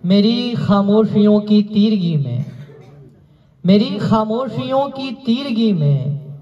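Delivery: measured, projected mushaira verse; declaimed Urdu couplets; poetry recitation. Acted. Recites ghazal.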